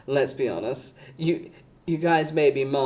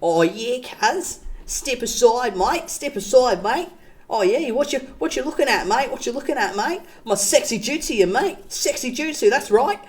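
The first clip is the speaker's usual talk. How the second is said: higher, hammed-up Australian bogan swagger; bogan Aussie accent; 9 semitones higher than usual. Aussie weeb bogan eyes up people, high hammed-up accent. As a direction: strut like a cheeky Aussie lout. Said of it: "the accent".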